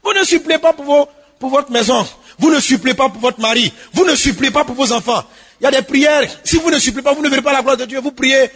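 fiery sermon voice; preaching at full force. Acted. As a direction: hammer it home to the congregation.

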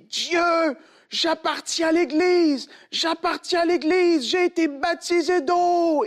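high, slow, loud desperate cry; crying out to God. Retold or imitated. Boy falling into the tunnel crying out to God, slow and loud. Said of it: "il s'est mis à crier".

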